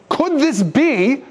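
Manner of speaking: very high, slow, hushed wonder; slow, high-pitched amazed question; wondering crowd. Retold or imitated. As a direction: ask it in disbelief.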